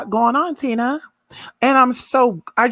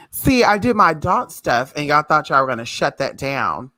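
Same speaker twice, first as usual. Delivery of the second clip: ordinary talk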